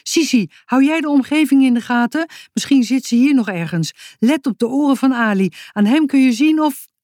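high, urgent instructions; calling out. A narrator's reading. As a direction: fire off orders in a hurry. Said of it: "riep Boutje".

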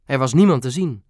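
ordinary delivery, nothing marked

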